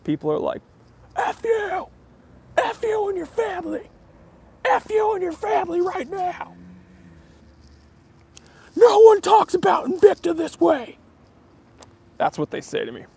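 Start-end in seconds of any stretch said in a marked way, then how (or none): 1.2-11.0 very high-pitched mock shouting; put-on high-pitched hysterical voice, imitating a furious fan; outraged commenter. Watch fans telling off the reviewer over Invicta, in a high mock rant.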